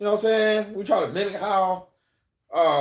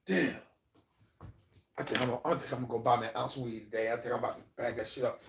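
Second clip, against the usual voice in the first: mock inner thought; 5 semitones lower, 7 dB quieter, faster than usual. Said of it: "trying to figure out".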